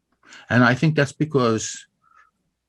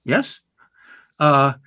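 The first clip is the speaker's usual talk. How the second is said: normal talking voice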